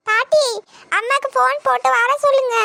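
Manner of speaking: put-on high, loud little girl pleading; small girl begging grandma to call her mother; crying child voice.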